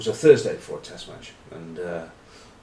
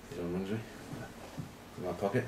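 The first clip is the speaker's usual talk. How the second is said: quiet; 3 semitones lower, 15 dB quieter than usual. Real, not acted. Phone vibrating in pocket.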